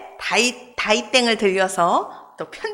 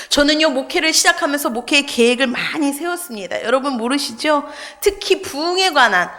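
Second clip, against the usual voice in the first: ordinary delivery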